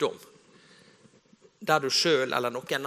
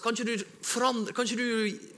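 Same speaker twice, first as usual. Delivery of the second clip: pleading prayer; 8 semitones higher than usual. Retold or imitated.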